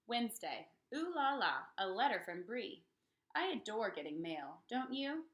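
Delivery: plain reading voice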